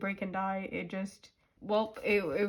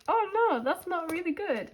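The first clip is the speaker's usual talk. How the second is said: shocked; 8 semitones higher than usual. Retold or imitated. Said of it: "And I was like".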